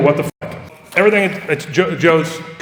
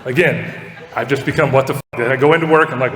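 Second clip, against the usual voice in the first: ordinary talk, nothing marked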